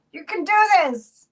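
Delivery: high, loud encouragement; playful cheering on. Real, not acted. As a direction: cheer them on like a coach. Playfully urging viewers to text friends.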